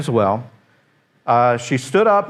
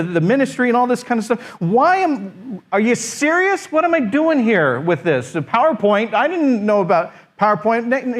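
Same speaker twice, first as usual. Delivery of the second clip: much higher, pleading and frustrated complaint; exasperated venting; 6 semitones higher than usual. Retold or imitated. New pastor complains to God at home, high and exasperated. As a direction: throw your hands up at God, voice climbing in protest.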